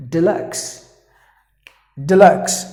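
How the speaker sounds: Deluxe said clearly, stress on luxe; model word. Shown as a demonstration. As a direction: repeat Deluxe as a drill. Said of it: "You have to pronounce it with".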